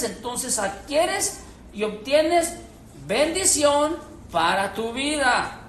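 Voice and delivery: normal talking voice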